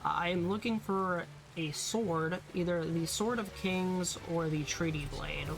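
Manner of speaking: normal talking voice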